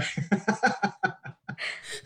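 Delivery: high, uncontrolled laughing fit; helpless laughter. Real, not acted. Podcast guest losing it at the tennis impression, helpless laughing. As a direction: completely lose it laughing. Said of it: "Oh my God, [name]'s lost it".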